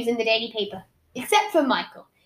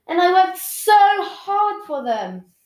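upset plea; 8 semitones higher, 6 dB louder, much slower than usual. Acted. Upset sister protesting.

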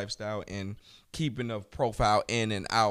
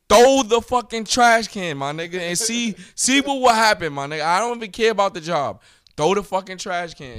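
loud, high-pitched reenacted dare; retold heated challenge; 6 semitones higher, 7 dB louder than usual. Teacher retelling a trash can standoff with a student, heated challenge. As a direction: push the voice up and dare them.

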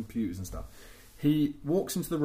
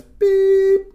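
very high, loud beep noise; imitated beep; 14 dB louder than usual. Telling the voicemail story, a high loud mock beep. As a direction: sound like the answering machine tone.

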